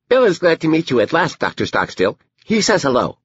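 put-on very high little-girl voice; Edie, seven-year-old girl. Daughter talks about her brother Bill, high child voice. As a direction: speak up high as a small, earnest child.

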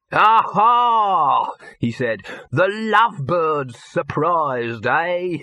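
high, drawn-out gleeful pounce; brash showman. Acted. Dibbler surprises the young couple, brash and gleeful. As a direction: crow it like a huckster catching them out.

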